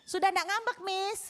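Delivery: high, sing-out teasing question; teasing pupil. Retold or imitated. Pupil greets returning teacher, teasing. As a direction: imitate a cheeky pupil needling the teacher. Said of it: "si anak ini lagi nggodai lagi".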